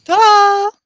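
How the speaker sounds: high, loud, drawn-out ta-da; triumphant flourish. Acted. Player showing off a lit-up cup, triumphant flourish. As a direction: sing out a showy ta-da.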